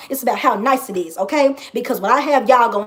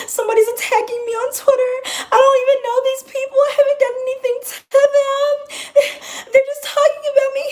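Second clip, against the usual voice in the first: high, crying, whining voice mocking her old self; mock crying whine. Mocking her past self crying to friends about Twitter, high wailing whine. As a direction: wail it like a fake sob story, voice way up. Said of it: "I, I would just cry".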